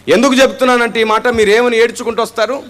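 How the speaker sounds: normal talking voice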